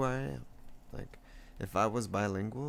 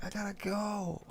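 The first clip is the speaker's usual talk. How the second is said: mock high excuse; 12 semitones higher than usual. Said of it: "It's like".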